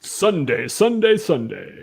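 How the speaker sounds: drawn-out monster truck announcer. Pretend. Showing friends his voice, as a monster truck announcer. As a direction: chant it like a rally commercial. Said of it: "I like my monster truck voice".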